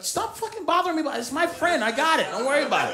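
exasperated husband waving her off; annoyed husband. Retold or imitated. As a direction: snap at her to drop it. Husband snapping at wife.

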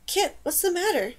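concerned question. A narrator's reading. Dane to her dragon clawing its muzzle, concerned.

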